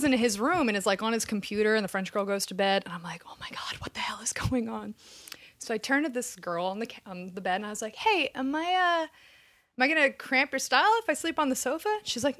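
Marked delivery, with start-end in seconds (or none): 3.3-4.9 hushed, disbelieving whisper; panicked whisper. Retold or imitated. Woman to herself, roommates gone to bed, panicked whisper. 8.0-11.9 high, careful little request; tentative asking. Retold or imitated. New arrival asking girl on the bed for the sofa, tentative. Timid request to roommate, acted.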